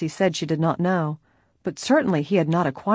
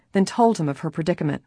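plain reading voice

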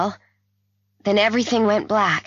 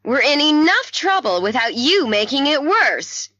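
high, heated scolding; angry reproach; 9 semitones higher, 4 dB louder than usual. A narrator's reading. Margaret scolding the caller for making trouble worse, angrily. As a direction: scold them, pitch climbing.

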